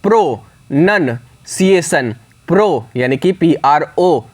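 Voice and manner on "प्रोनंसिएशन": प्रोनंसिएशन very slow, pro-nun-ciation in three parts; syllable by syllable, प्रोनंसिएशन; split into parts. Shown as a demonstration. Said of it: "divide करोगे तीन part में".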